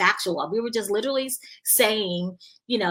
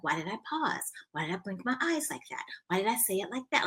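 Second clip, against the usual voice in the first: soft, questioning self-talk; hushed internal nitpicking, each question rising; inner self-criticism; 8 dB quieter than usual. Retold or imitated. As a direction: pick yourself apart under your voice. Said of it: "I didn't say it out loud".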